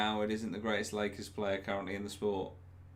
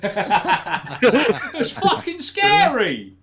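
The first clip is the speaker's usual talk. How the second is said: laughing it out, higher and louder; 12 semitones higher, 12 dB louder, much slower than usual. Real, not acted. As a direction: blurt it through real laughter, slowly. Laughing recalling haunted scare event.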